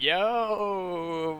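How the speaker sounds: high, long drawn-out yo; mock-panicked plea to hold on; alarmed protest. Bystander to cops with hands on guns, alarmed protest.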